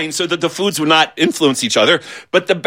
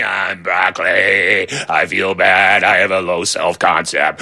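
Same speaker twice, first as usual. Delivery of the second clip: gloomy broccoli; 9 semitones lower than usual. Pretend. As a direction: sulk out each word like nobody loves you. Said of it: "the hamburger would be going".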